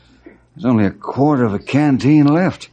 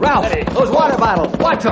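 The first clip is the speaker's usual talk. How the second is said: high, sharp alarmed call; urgent warning shout; 9 semitones higher than usual. Acted. To Ralph as the horses panic, a high alarmed shout. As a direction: warn him before it's too late.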